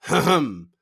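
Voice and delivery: loud throaty "hum, hum"; throat-clearing hum. Shown as a demonstration. Coach shows an exercise between read words, throaty hum. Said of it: "entre chaque mot, tu dis".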